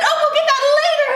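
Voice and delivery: high, loud, hurried brush-off; giddy. A narrator's reading. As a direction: toss it off fast in high excitement. Excited princess brushes off frog.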